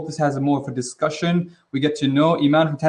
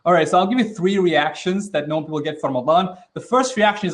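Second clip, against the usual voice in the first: usual speaking voice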